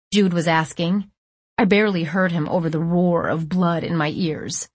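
ordinary delivery

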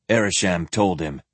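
usual speaking voice

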